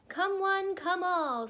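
loud, high town-crier squawk; squawking albatross. A narrator's reading. Albatross summoning the town to a meeting, squawking. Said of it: "squawked the albatross".

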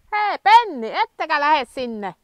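loud, high call to stop the dogs wandering off; loud high summons across the yard. Real, not acted. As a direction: shout the dogs back toward you. Shouting dogs back from straying.

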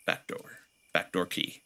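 whispered, low item names; whispered joke. Acted. Player sneaking a pun about the back door, whispered. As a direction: breathe the words like a sly joke.

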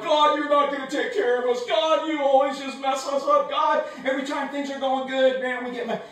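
high, loud whining gripe at God; grumbling complaint. Acted. Israelites grumbling at God in the wilderness, loud and whiny. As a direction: complain loudly like ungrateful people.

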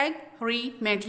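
एग्रीमेंट slowly split as एग, री, मेंट; syllable by syllable. Shown as a demonstration. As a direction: say एग्रीमेंट in three separate beats. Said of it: "three syllables में divide". (एग्रीमेंट को तीन हिस्सों में तोड़कर)

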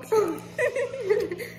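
high burst of laughter; laughing at the sour taste. Real, not acted. Reacting to sour candy.

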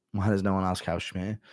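ordinary delivery

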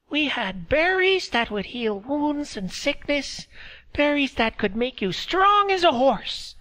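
put-on high, thin old woman's voice; old woman speaking through a laugh; old Meredy. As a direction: voice her as a frail old village woman. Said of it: "She laughed again".

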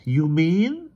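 high, hesitant question. A narrator's reading. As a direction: begin to ask, voice lifted and unsure. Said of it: "she began hesitantly".

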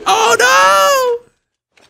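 high loud cry of dismay. Real, not acted. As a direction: wail at the near loss. Teammate about to lose.

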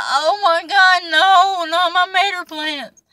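high, crying wail of dismay, dragged slow. Retold or imitated. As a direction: wail over the lost plants like they're gone forever. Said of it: "I was like".

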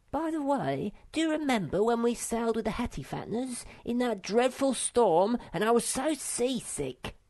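high, bossy little creature giving orders; bossy little Sniff. Acted. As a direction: play the self-important path pioneer, pitched high. Sniff voiced in story.

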